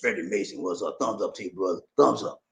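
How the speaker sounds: deep, muted message read-out; low hushed voice. Acted. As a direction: drop it down low and quiet. Reads a message in another voice.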